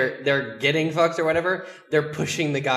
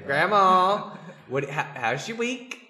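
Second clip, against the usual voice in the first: put-on a lighter Southern accent, slowing down; southern grandma chat; 4 semitones higher, slower than usual. Pretend phone call to grandma, slow Southern accent. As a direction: ask grandma about her week in a Southern drawl. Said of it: "I'll even get more fucking southern".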